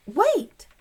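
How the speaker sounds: sharp high shout of wait; loud call. Acted. Ruth Rose calling out to friends, loud. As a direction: stop them all with a cry.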